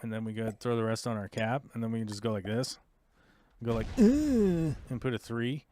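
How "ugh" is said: high, loud "ugh"; high-pitched drawn-out grunt; playful effort noise. Pretend. Player showing viewers a troop move, high playful grunt. Sound effect for placing troops.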